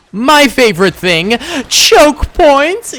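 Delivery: loud, high hype reveal of a favorite thing; gleeful hype. Real, not acted. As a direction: belt it out, high and loud, relishing it.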